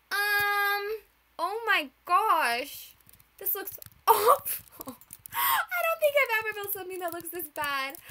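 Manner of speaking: high, loud laughing half-wail; horrified laughing. Real, not acted. Builder seeing her awful food build, laughing in horror. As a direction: laugh and groan at the mess at once.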